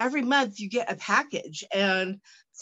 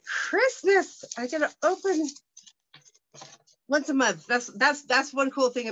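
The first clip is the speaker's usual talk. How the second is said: excited; 6 semitones higher, 3 dB louder than usual. Real, not acted. Excited unboxing, big pitch jump.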